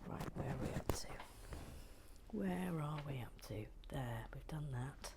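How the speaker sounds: quiet unvoiced whisper while working; hushed ASMR-style whisper; soft whisper. Real, not acted. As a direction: whisper to the camera. Whispered craft talk.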